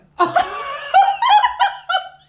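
high, loud laughing cry; half laugh, half shout; shrieking disbelieving laugh. Real, not acted. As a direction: burst out laughing at the absurdity. Reliving outrage at his excuse.

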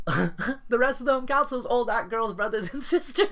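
laughing hard while talking. Real, not acted. Ex-member laughing while describing her family cult. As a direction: laugh through the words, high and loud.

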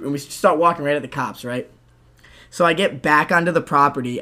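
ordinary talk

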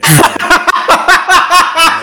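long, loud, high-pitched laughing fit. Real, not acted. Cracking up after his joke about the accent, long loud laugh. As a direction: lose it completely.

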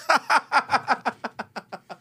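high, long peal of laughter; helpless high cackling laugh; burst of laughter. Real, not acted.

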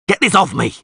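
high, urgent cry; roaring. A narrator's reading. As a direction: yell to be freed. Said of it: "he roared".

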